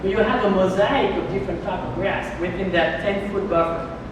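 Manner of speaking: normal talking voice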